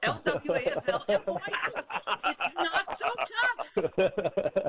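fast, giggling through a mouthful; laughing. Real, not acted. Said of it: "banana in my mouth".

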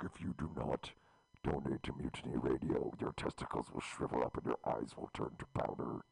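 very low, rasping whispered devil. Retold or imitated. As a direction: growl it out like Satan. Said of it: "There's my devil voice".

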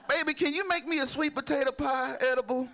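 high-pitched imitation of an old grandma; playful mock-grandma voice. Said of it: "Ain't that some grandma shit".

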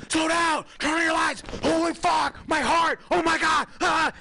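high, loud, frantic yelling; panicked screaming. Retold or imitated. Screaming at the nephew driving, panicked yell. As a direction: scream it like a terrified passenger. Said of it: "I'm, like, screaming".

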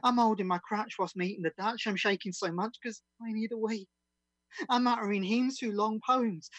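lively, rhythmic comic verse read; comic performance poem. Acted. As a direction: deliver it as a bouncy comic ditty.

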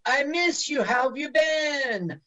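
lightly mocking high sweetness; sweet high voice. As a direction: coo it high and sugary. Voicing character's sweet greeting.

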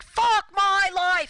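high, loud, slow wail of despair; despairing yell. Retold or imitated. Neighbor imitating the street guy's despairing yell. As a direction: moan it out like a man at rock bottom. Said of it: "stares at himself and goes like".

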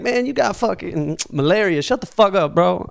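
mosquito bro friend. Pretend. Mosquito friends brushing off his story, lightly voiced bro. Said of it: "his friends are like".

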